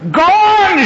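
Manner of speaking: high, drawn-out furious holler; full-voiced indignant yell. A narrator's reading. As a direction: yell it out across a pasture. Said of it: "she yelled".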